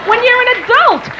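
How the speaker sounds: high-pitched comic indignation; exasperated rant. Acted. Exasperated rant at adult autograph seekers.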